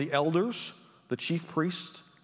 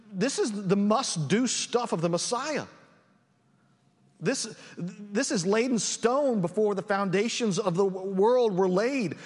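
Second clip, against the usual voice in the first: usual speaking voice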